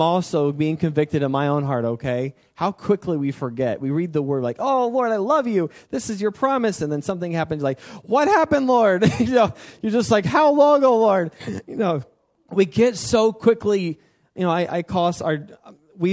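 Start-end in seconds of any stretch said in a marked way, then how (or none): 4.6-6.8 high-pitched, mock-adoring prayer; gushing devotion. Believer reading God's word with delight, acted gushing devotion. 8.0-9.1 high, loud cry of complaint; mock dismayed wail. Believer crying to the Lord when trouble hits, mock dismay. 10.2-11.3 high, loud, hammed-up lament; mock impatient wail. Believer tired of waiting on the Lord, mock wailing.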